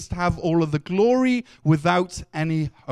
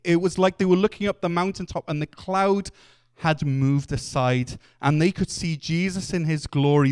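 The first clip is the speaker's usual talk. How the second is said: normal talking voice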